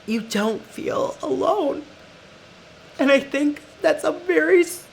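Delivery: real tearful voice, pitched high; crying. Real, not acted. Podcaster telling listeners about loving yourself, crying. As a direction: push the words out through tears, halting. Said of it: "I didn't mean to cry".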